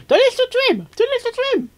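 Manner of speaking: a little inner voice begging you; good conscience voice. Retold or imitated. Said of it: "another conscience saying".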